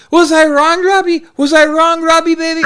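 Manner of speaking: high imitation of a friend asking; mock appeal. Friend asking Robbie to judge him, mock appeal. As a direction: put on a high, insistent questioning voice. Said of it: "And he goes".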